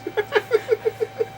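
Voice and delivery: a short high burst of laughter; amused laugh. Real, not acted. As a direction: laugh at your own silly idea. Laughs at his own description.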